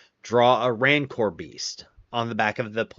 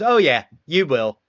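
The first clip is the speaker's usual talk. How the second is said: louder, knowing threat from the sergeant; mock menace; 5 semitones higher, 7 dB louder than usual. Sergeant to the recruit who got him, a loud mock menace. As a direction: drill sergeant promising payback, loud and knowing.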